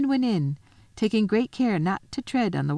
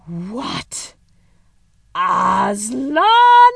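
drawn-out queen's outcry, swelling loud; shocked cry; 4 semitones higher, 9 dB louder, much slower than usual. Acted. The queen hears of Aslan, crying out loud and high. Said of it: "cried the queen".

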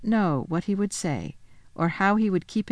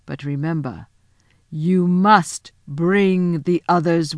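slow, louder, a hint of threat under sweetness; the Queen, slow and menacing; 4 dB louder, slower than usual. The Witch Queen warning Edmund to bring the others, slow and veiled menace. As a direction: draw it out like a witch hiding her temper.

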